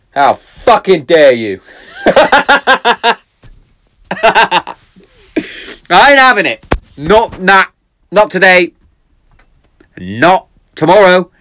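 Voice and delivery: high, loud hammed-up protective fury; mock outrage. Dad about his daughter's crush, mock fury. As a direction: play the furious overprotective dad.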